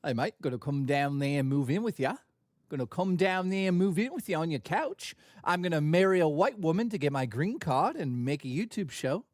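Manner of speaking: a loose mock Australian accent. Streamer clowning for chat in mock Australian accent. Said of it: "Sounds Australian".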